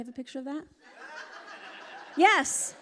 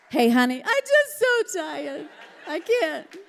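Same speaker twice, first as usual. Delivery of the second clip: mock whiny tired wife; 3 semitones higher, 5 dB louder, slower than usual. Wife whining to husband that she's too tired. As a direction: whine it out and laugh. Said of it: "that's how we feel at the end".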